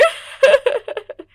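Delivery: high, loud wailing sob. Acted. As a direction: break into a theatrical sob. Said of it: "रोने लगती है".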